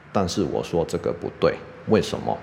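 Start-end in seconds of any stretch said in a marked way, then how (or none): none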